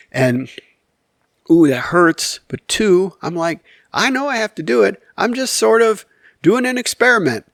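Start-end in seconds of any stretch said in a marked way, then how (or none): 3.9-7.5 high-pitched retold excuse; acted-out husband making excuses; mock defensive. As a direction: justify yourself, pitch lifted.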